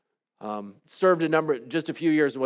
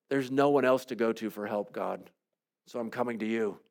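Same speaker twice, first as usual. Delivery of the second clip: hushed plea; 5 semitones lower, 6 dB quieter than usual. Acted. Intimate lament to God.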